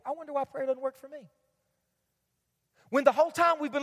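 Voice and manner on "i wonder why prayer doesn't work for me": mock inner thought. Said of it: "and we think".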